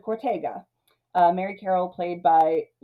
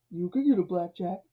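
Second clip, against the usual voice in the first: soft, low-key put-on reply; deep-voiced conscience; 4 dB quieter than usual. Myron's conscience talks him up to face gangsters, mock deep voice. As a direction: coolly counter in a muted character voice. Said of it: "it's this deep, deep voice".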